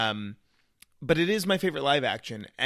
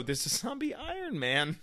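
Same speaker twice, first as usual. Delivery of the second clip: much higher and softer voice; high, soft aside; 7 semitones higher, 5 dB quieter than usual. Acted. Fan dwelling on the zombie Iron Man, high soft aside. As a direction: lift it high and keep it small.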